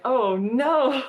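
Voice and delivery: a playful groan of dismay; a team hearing a new claim against them, mock dismay. Reacting to compensation claim.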